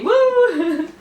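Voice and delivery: high, loud little cheer; cheering woo. Real, not acted. Cheering the subscriber goal, excited whoop.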